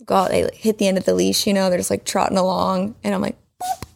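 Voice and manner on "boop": very high mock surprise to a dog; sing-song baby voice. Trainer to dog after the leash pop, high sing-song. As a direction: coo it high, feigning innocence. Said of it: "having the little sing song".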